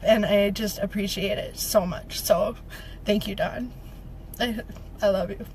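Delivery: soft, low, choked-up thanks; getting emotional. Real, not acted. Speaker thanking Dawn for a card, emotional and hushed. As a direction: let the thanks sink low and quiet with real emotion. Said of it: "I'm getting emotional again".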